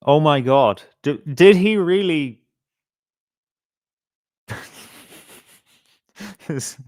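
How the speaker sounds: normal talking voice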